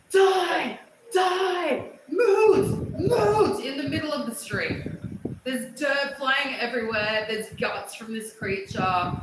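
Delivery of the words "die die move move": high, loud frantic shouts; frantic yelling. Retold or imitated. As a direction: yell at the bug to die. Retold yelling at the creature.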